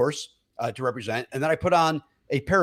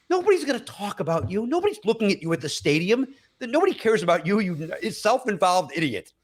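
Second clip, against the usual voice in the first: scolding himself; 6 semitones higher than usual. Retold or imitated. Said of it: "I was like".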